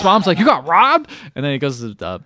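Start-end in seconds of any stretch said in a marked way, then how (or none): 0.4-1.1 high, loud incredulous question; mock a mother's startled disbelief; shocked mom. Mom reacting to the robbery.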